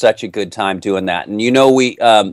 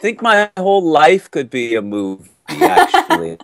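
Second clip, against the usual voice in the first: much higher, words breaking into laughter; laughing; 11 semitones higher than usual. Real, not acted. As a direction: laugh through the line at your own joke. Laughs at his life being a movie.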